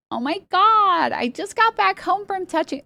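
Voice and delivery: put-on high, drawn-out Valley Girl voice. Shown as a demonstration. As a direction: do your best exaggerated Valley Girl. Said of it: "Let's hear your best Valley Girl".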